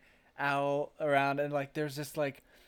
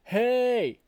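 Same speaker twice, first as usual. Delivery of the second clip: loud, higher call over a fence; shouted hey; 9 semitones higher, 8 dB louder than usual. Retold or imitated. Nick Cage calling over the fence to Birdie, shouting. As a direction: yell to get his attention.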